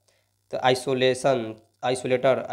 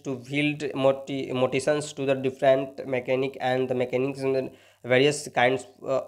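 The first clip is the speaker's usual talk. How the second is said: slow reading aloud; much slower than usual. Shown as a demonstration. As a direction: read the question slowly so students follow. Reading English question aloud. (धीमी, ज़ोरदार आवाज़ में प्रश्न पढ़ना)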